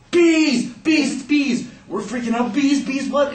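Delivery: high-pitched panicked alarm cries; panicked cry. Acted. Telling his playmate what's outside, panicked. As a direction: cry out in short, high bursts. Kids panicking at sight of bees.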